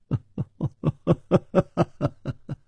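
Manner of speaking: high, evenly pulsed laugh; high staccato laugh. A narrator's reading. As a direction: let out a long run of light, high ha-ha laughs. 楚留香认出梁妈时发笑. (一连串高声的笑)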